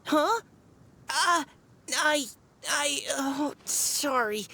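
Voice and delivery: stammering, high and flustered apology. Acted. Girl catching herself after her outburst, flustered. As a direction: trip over the words, embarrassed. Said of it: "I got a bit too excited".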